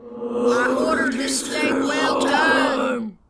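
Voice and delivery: very slow, loud drawn-out shout; scary ghost voice. Shown as a demonstration. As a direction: roar it to terrify the waiter. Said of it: "you're so close".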